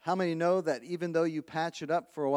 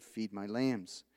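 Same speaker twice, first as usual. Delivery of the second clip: gentle Jesus; 6 semitones lower, 5 dB quieter, slower than usual. Acted. Jesus answers Peter gently.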